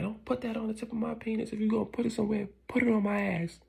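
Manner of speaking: high-pitched outraged protest; losing it. Retold or imitated. Husband losing it over the rose, in a high outburst. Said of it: "He loses it".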